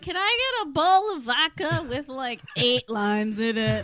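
loud, sloppy mock-drunk request; drunk ordering. [name] orders vodka at the bar, drunk ordering. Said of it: "Are you drunk?".

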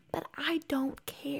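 hushed, breath-only dismissal; whispered aside. Real, not acted. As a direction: whisper it under your breath.

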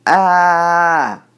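high, loud drawn-out yawn; yawn sound. Shown as a demonstration.

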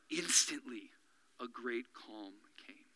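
whispered, slow scripture line; hushed reading. A narrator's reading. Preacher reading the calm in a whisper. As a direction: let the calm settle in your voice.

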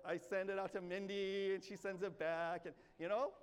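ordinary talk, nothing marked

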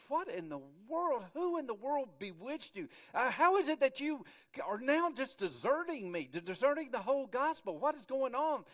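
exasperated, high and loud rebuke; Paul. Acted. Apostle scolding the church at Galatia, exasperated. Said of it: "Paul said".

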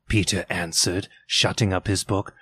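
plain reading voice

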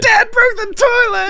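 put-on high, slow kid's gleeful shout; excited kid shout. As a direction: yell it like a delighted child. Son voiced in story.

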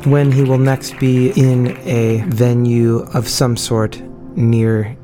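usual speaking voice

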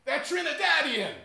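a fuming local yelling at the tourist, slow and loud; fuming shout. Retold or imitated. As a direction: bark out the correction in a rage. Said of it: "he's still fuming".

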